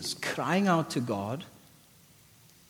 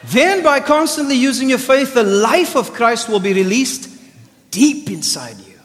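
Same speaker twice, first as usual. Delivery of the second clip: preached scripture; 11 semitones higher, 12 dB louder than usual. Acted. Scripture proclaimed loudly.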